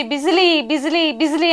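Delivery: half-hearted retold yell across a field; mild mock calling out. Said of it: "అని గట్టిగా అరుస్తూ ఉంటాడు". (గుర్రాన్ని గట్టిగా పిలుస్తున్నట్టు తేలికపాటి అనుకరణ)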